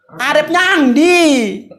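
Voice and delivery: arep nyang ndi spoken very slowly, word by word; slow teaching pronunciation; slow demo. Shown as a demonstration. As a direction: model the phrase slowly for a learner. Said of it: "Itu bahasa Jawanya apa?".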